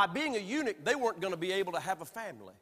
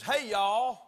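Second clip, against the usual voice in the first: a broad Southern "Hey, y'all"; Southern greeting; 3 semitones higher, 7 dB louder than usual. Retold or imitated. Preacher showing a Southern greeting, drawled Southern. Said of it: "when somebody says".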